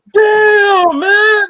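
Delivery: high, loud crying-shout dog voice; overjoyed dog. Pretend. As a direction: wail like a dog that missed you, high and loud. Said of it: "a dog is like".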